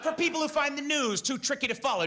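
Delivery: ordinary talk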